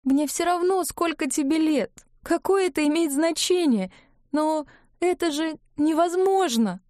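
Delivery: high, tearful protest; tearful outburst. A narrator's reading. Ivga protests to Klavdiy in a tearful high voice. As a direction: cry it out, pitched high. Ivga weeping, confessing love.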